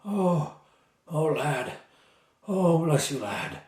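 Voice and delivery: halting, grateful exclamations with pauses between; relieved captive knight. Acted. Captive knight thanking his young rescuer, relieved.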